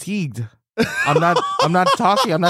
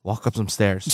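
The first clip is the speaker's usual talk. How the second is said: ordinary talk, nothing marked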